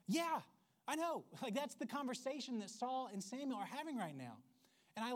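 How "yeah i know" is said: high, loud, mock exasperated yeah. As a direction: throw up your hands at the kid. Exasperated parent retort.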